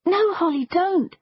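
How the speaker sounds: high-pitched, urgent warning; alarmed plea. A narrator's reading. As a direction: plead to stop her in alarm. Friends try to stop Holly.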